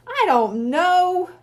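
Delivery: slow, loud, near shout; bursting out, almost a shout. A narrator's reading. Bradley near shouting at his friends, frustrated. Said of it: "Bradley almost shouted".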